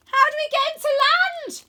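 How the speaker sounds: very high, loud panicked shout; boy on a dragon shouts to friend. Acted. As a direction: yell down to her. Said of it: "I shout to Rose".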